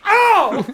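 high, loud "ow"; sharp, high yelp; yelp of pain. Acted. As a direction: cry out as if suddenly hurt. Connor hurt while being lowered.

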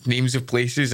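normal talking voice

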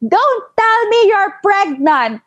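high-pitched, drawn-out mock shout; mother's shout. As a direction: act out a scandalized mom shouting, slowly. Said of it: "she shouted at me".

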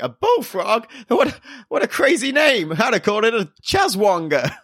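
high, amazed Australian bloke; hammed-up Australian marvelling at a name; Australian shopkeeper amazed at the bullfrog, high Australian accent. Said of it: "He's like".

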